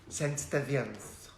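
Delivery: put-on timid posh kid.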